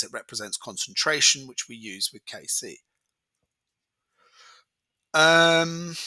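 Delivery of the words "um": ordinary talk, nothing marked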